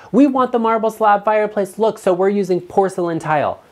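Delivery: put-on high voice, gently ridiculing; mocking quote. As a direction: parrot them in a high, silly voice. Mocking a homeowner's reasoning.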